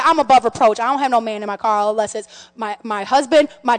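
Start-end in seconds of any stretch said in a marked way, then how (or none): none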